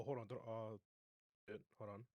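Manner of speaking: ordinary talk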